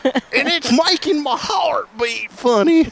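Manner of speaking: high, slow, hammed-up Oklahoma twang; mock twangy drawl. Host retells uncle's tornado tale to Helen, high drawn-out Oklahoma twang. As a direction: drag every word through an Oklahoma drawl. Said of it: "is that not the twang?".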